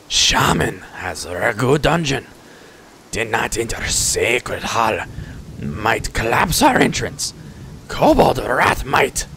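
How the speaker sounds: slow, stretched creature voice; deliberate pidgin, building to an angry finish; kobold chief. Acted. Kobold chief continues the story.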